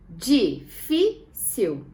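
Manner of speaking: DI, FÍ, CIL with a clear pause between each; teacher splitting "Difícil" into di... fí... cil; syllable by syllable. Shown as a demonstration. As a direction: break "Difícil" slowly into three separate syllables. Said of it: "vamos separar em sílaba para encontrar".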